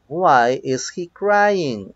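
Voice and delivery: slow, drawn-out example question; slow model reading. Shown as a demonstration. Teacher reads the next example to students, slowly and deliberately. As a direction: stretch each word out for learners.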